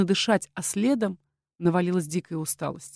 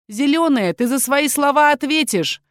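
высокий, возмущённый окрик; пригрози в перепалке, повысив голос; злая угроза; 7 semitones higher, 4 dB louder than usual. A narrator's reading. Перепалка перед дракой. (возмущённо и угрожающе)

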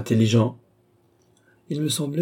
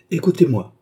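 firm, raised, commanding call; 5 semitones higher, 5 dB louder than usual. A narrator's reading. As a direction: cut him off firmly. Doctor silences angry Philippe.

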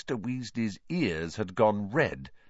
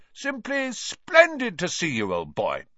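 high, plummy mock-grand greeting; overdone old-chap heartiness; mock pompous; 11 semitones higher, 4 dB louder than usual. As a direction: gush with exaggerated formality. Twins mock Percy's pompous greeting.

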